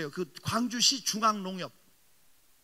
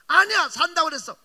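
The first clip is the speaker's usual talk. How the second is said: put-on a child's stubborn retort, louder and higher; insisting child; 5 semitones higher, 10 dB louder than usual. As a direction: insist like a small girl digging in her heels. 딸이 우기는 말. (고집부리는 아이처럼 크게 우기며)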